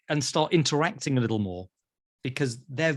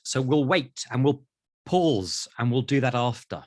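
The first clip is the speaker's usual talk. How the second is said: ordinary talk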